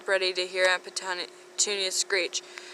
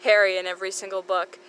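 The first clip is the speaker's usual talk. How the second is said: usual speaking voice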